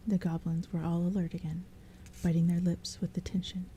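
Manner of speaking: plain reading voice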